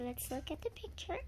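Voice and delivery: usual speaking voice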